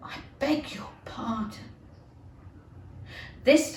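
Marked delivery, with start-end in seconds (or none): 0.1-1.7 soft breathless whisper; shocked whisper. Acted. The frightened mouse whispers back at Alice.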